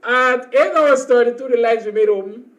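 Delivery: usual speaking voice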